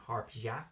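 harpia with a "ya" ending; careful Greek-style harpia; Greek pronunciation. Shown as a demonstration. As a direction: say harpia as "har-PYA". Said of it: "correct, uh, Greek pronunciation".

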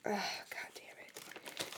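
muttered curse, whispered under breath; whispered cursing. Real, not acted. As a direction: hiss it to yourself, annoyed. Annoyed at ice cream lid.

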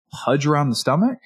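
"Pudge around the stomach?" as a question, lifting on "stomach"; echoed question. Shown as a demonstration. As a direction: repeat "Pudge around the stomach?" back as a gentle probe. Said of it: "say it with a downward inflection".

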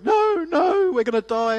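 high, scared yelp of a panicking passenger; panicked friend. Retold or imitated. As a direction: squeal it like you're sure the car will crash. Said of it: "my friend from University of Queensland going".

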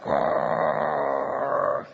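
low mock grinding growl of worn brakes; brake grinding noise. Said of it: "It's more of just like".

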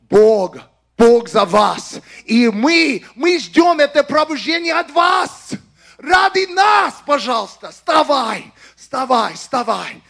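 impassioned loud plea in Russian, rising high then easing; emotional announcement. Real, not acted. Preacher pleading with Russian speakers to rise, emotional shout. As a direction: shout it as an urgent appeal. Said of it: "apologize for these emotional announcements".

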